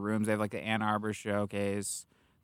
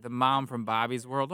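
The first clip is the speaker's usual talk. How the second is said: ordinary talk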